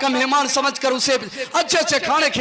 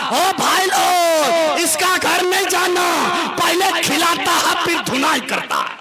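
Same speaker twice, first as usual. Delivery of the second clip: public announcement; 2 semitones higher than usual. Retold or imitated. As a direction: warn everyone at full voice. Guest announcing to villagers. (गाँव वालों को चिल्लाकर ऐलान करता मेहमान)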